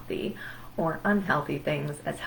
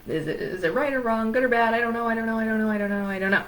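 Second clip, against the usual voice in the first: higher, run-on mock panic; acted anxious dithering, pitched up; spiraling doubt; 4 semitones higher, much faster than usual. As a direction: tumble through the doubts without a break. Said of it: "doubting yourself, doubting your relationship".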